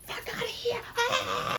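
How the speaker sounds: high unvoiced mock squeal; mock dismissive cry. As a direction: squeal it off as a joke. His implied brush-off line.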